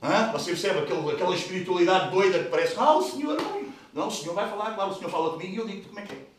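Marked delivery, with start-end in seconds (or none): 2.8-3.7 mock spiritual voice. Mocking over-spiritual people.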